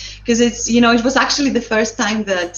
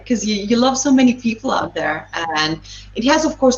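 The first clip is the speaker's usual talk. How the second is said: ordinary talk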